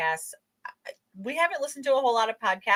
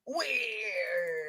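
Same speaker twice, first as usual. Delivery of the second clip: shock jock voice; 9 dB quieter than usual. Retold or imitated. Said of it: "his Shock Jock voice".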